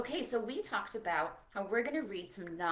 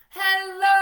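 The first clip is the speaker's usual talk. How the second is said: shouted high and very loud; big loud shout of hello; 9 semitones higher, 15 dB louder than usual. Shown as a demonstration. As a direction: belt it out as loud as you can. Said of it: "as loud as you can".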